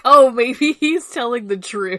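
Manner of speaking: loud, high, laughing outburst; mock realization. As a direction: blurt it out like a hammed-up sudden revelation. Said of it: "They're like".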